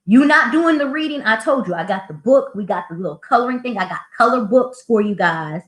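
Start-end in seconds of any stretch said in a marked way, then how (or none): 0.0-1.2 high, pointed question; teacher scolding. Retold or imitated. Teacher asks boy in group, high and pointed. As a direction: call the kid out.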